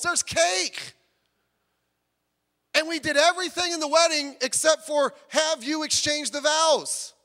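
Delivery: loud, high outcry; exasperated protest. Acted. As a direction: cry out in disbelief at what got skipped. Bride and groom protesting confusedly.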